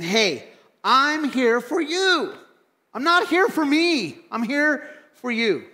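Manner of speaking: high, slow, pleading offer; selfless voice. Pretend. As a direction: sing out a selfless offer slowly. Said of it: "is saying".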